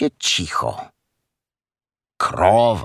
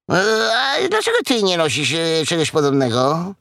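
high-pitched piglet voice; curious piglet; 7 semitones higher than usual. Acted. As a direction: ask hesitantly in a high little voice. Piglet asks the cow.

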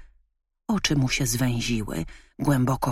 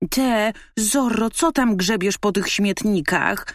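dog barking; 6 semitones higher, 3 dB louder than usual. A narrator's reading. Dog mocks raccoon rummaging in trash. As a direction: sneer at the masked scavenger. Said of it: "zaszczekał pies".

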